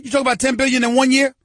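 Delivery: high, fast disbelieving repeat; incredulous. Real, not acted. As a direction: ask it again as if you can't believe it.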